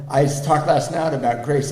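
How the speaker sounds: ordinary talk, nothing marked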